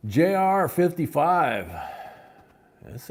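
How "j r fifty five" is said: loud, slow, high bid call; auctioneer call. Acted. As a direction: announce the bid to the room.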